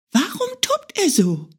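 high bunny question; a small hare asking again; curious little hare. Acted. Hare asks the beetle, high and curious.

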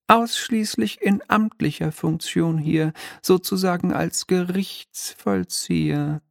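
high, mannered little devil voice; a smug minor demon, lightly shrill and polite; oily demon clerk. Acted. Demon explains his duty.

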